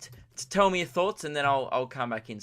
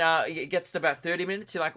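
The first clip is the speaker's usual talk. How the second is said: normal talking voice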